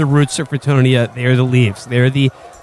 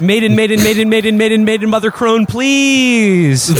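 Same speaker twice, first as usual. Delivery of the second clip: shouted prayer; 7 semitones higher than usual. Acted. Player begging Mother Crone for the storm, shouted prayer. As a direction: pray urgently in a raised, insistent pitch. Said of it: "as you shout this prayer".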